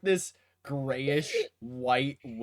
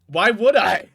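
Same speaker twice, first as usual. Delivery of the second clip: loud, higher indignant rock; talking rock; 4 semitones higher, 8 dB louder, faster than usual. Acted. Rock refusing to explain itself to players, indignant rock. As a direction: fire back as an affronted rock. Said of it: "I'm talking to the rock".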